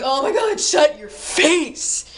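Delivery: mock outburst of annoyance; exasperated retort. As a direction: act fed up, dragging out the final word. Acted exasperated reply to girl.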